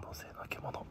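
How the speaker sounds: whispered aside. Real, not acted. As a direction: breathe the joke under your breath. Muttered aside joke.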